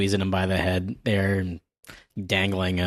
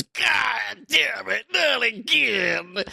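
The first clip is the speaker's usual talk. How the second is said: high, drawn-out cartoon wail of frustration; hammed-up exasperated outburst; exasperated yell; 13 semitones higher, much slower than usual. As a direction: stretch every word out in a high mock shout. Voicing exasperated character in manga.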